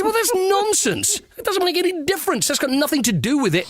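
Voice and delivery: high-pitched, incredulous dismissal; hurried, high-pitched indignation; exasperated rebuttal. Retold or imitated. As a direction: argue back in scornful disbelief, voice shooting up. Acted exasperated rebuttal in an argument.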